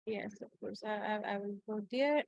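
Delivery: ordinary delivery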